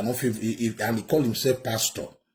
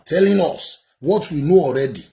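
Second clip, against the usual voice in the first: exasperated; 5 semitones higher, 9 dB louder than usual. Real, not acted. Commentator angrily rebuts clip.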